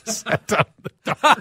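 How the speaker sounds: words squeezed out through a high laugh; cracking up mid-phrase, voice jumping high. Real, not acted. Host laughing at a listener's text, high giggling delivery.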